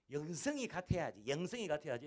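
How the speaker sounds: mock the pastor's own preaching tone; son imitating pastor. Pastor replays his son mimicking him, hammed-up preacher voice. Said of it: "재민이가 저를 흉내내요".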